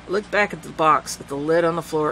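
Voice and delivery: ordinary delivery, nothing marked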